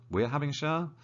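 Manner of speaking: rising, doubtful question on "We're having a shower?"; puzzled double take. Shown as a demonstration. Teacher catching her own mistake, puzzled. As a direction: catch your own slip mid-sentence.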